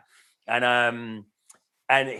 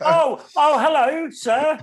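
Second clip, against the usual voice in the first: very high, louder mock-polite greeting; over-polite driver; 9 dB louder, much slower than usual. Imitating a rude driver turned meek, very high and polite. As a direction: squeak it like a suddenly polite driver. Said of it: "they get really polite really quickly".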